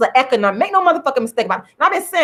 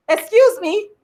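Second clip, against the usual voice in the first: 1 indignant excuse me; 7 semitones higher than usual. Real, not acted. Offended retort to a critic, sharper and higher.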